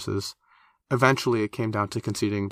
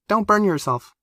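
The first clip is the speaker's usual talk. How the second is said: put-on high, bright-eyed girl voice warning; a young character cautioning, pitched up high; 12 semitones higher, 5 dB louder than usual. A narrator's reading.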